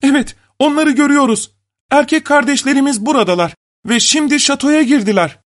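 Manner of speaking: high, excited cry without much volume; shouting. A narrator's reading. Sisters announcing the brothers have arrived, high excited shout. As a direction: call out the news, pitched very high. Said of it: "Kızlar bağırmışlar".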